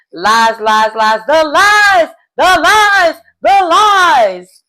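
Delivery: high, loud mock wail, drawn out; Candy Burr. Recapping a player's vote against a liar, loud impression. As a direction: belt out the accusation in a celebrity impression. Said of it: "in my Candy Burr's voice".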